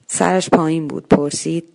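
plain reading voice

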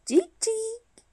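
high, soft little cheep; the chick greets the truck with a high peep. A narrator's reading.

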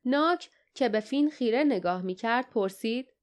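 usual speaking voice

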